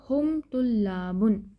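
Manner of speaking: हुम तुल्लाबुन, slow and deliberate with a long ला; slow model answer. Shown as a demonstration. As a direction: say हुम तुल्लाबुन slowly for learners. Teacher models the answer slowly. (हुम तुल्लाबुन धीरे-धीरे, ला को खींचकर)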